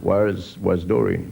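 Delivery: very low smooth chat-up croon; gravel-voiced lothario. Pub lothario chatting up a lone girl, very low croon. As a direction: purr the cheesy line down deep.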